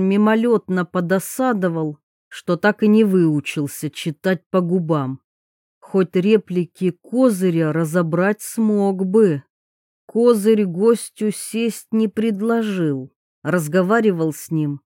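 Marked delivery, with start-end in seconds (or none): none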